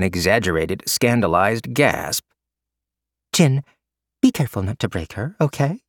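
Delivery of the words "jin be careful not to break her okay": fast playful teasing. A narrator's reading. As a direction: tease him, fast and sly. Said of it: "Mei Hua teased".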